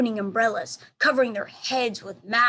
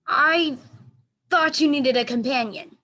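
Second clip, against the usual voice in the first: a touch higher and louder, friendly; lightly voiced gorilla; 5 semitones higher, 6 dB louder than usual. A narrator's reading. Gorilla Ivan greeting his dog friend, lightly voiced. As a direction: offer it kindly, like a gentle big friend.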